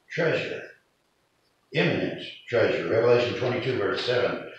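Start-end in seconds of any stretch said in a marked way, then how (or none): none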